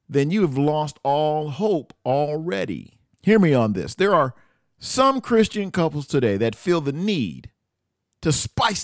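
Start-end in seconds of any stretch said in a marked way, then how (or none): none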